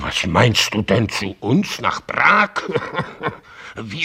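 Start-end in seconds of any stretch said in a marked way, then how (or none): 2.6-3.3 a brief scoffing chuckle; short laugh. Acted. Father laughing off his son's fear, short scoffing chuckle. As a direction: laugh off the worry.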